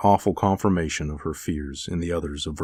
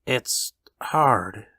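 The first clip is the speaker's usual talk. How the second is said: halting, higher-pitched sorrow; bereaved father; 5 semitones higher than usual. A narrator's reading. Father to the visitor about his loss, sorrowful. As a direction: pause before the last word, mildly mournful.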